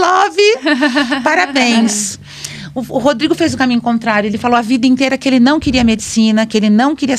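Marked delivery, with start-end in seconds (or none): 0.0-2.2 choked-up birthday wish. Real, not acted. Birthday wish to her son.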